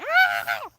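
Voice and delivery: short high mock scream. A short high yelp, retelling being charged by chickens. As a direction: yelp like you got startled. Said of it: "I screamed".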